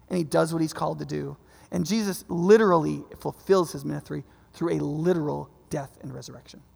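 normal talking voice

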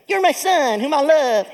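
high, loud voice breaking into a laugh; Mike Tyson. Shown as a demonstration. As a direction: play God as a comic boxer. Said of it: "What if God sounded like Mike Tyson?".

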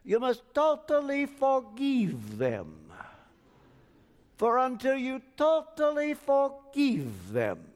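slow, solemn imitation of a Romanian accent. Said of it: "I can hear him now in his Romanian accent".

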